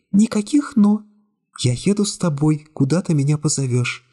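put-on young woman's voice. A narrator's reading. Secretary coolly refusing the hero's embrace, high young woman voice.

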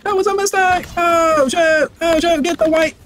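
ordinary delivery

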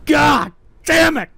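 high, wailing outrage at being laughed at; upset, near crying. Real, not acted. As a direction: cry out in wounded protest, rising to a shout. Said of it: "stop crying and acting like a man".

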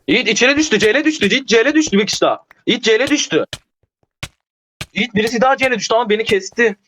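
high-pitched, rapid-fire alert to a teammate; urgent game callout. Real, not acted. Gamer telling Yiğit who went down, urgent high callout. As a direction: call out the fight in a high, excited voice.

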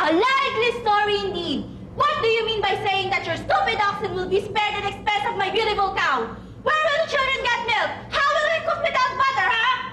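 very high, rapid-fire furious rant; shrill shouting wife. A narrator's reading. Wife furious her cow was traded, rapid high rant. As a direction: pour it out fast and outraged at him. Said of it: "his wife began to shout".

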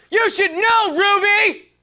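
very high, forceful yell; high-pitched, over-the-top scolding; shouted outburst. Acted. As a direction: yell it at the top of your range. Same line redone far more forcefully.

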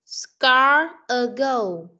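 very slow, careful model. Shown as a demonstration. Teacher prompting a stuck pupil, slow model. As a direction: draw out "score a goal" slowly. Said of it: "teacher models the phrase".

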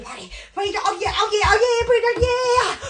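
normal talking voice

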